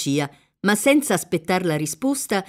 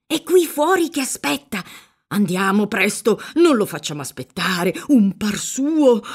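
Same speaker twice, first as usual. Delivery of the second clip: fussy priest anxious to oblige; flustered urging; 2 semitones higher than usual. A narrator's reading. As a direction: hurry someone along nervously. Priest hurries girl along.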